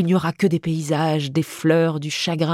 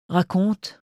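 feigned indifference; 2 semitones higher than usual. A narrator's reading. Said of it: "intéressée, mais qui feint l'indifférence".

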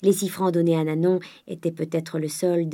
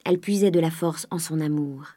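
ordinary delivery, nothing marked

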